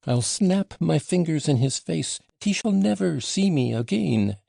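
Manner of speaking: high, loud, near-screaming outburst; hysterical scream. A narrator's reading. Grushenka swears off her lover, hysterical scream. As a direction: let the last words break into a scream. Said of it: "She uttered the last words in an hysterical scream".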